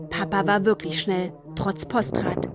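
ordinary delivery

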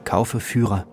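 normal talking voice

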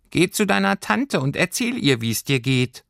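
high, tiny creature voice; a small homesickness sprite speaking up; little Heimweh voice. A narrator's reading. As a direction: homesickness creature answering Lilly from above. Heimweh gives advice.

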